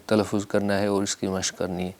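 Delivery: ordinary talk, nothing marked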